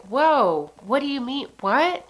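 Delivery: reliving the shock of the call; retold shock. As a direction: blurt it out as if just hit with the news. Hearing a friend has died.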